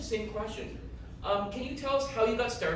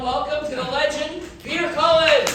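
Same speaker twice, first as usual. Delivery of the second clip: announcer shout; 6 semitones higher, 11 dB louder than usual. Real, not acted. Host announces guest to crowd.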